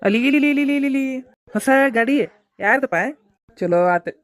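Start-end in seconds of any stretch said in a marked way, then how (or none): 0.1-3.0 excited. Real, not acted. Spots a new bike, excited.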